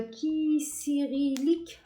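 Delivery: Kisirilik lentement, syllabe par syllabe; déchiffrer Kisirilik en étirant les sons, avec un accent étranger; prononciation hésitante du turc. Real, not acted. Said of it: "pour mon accent". (Kisirilik prononcé très lentement, avec application)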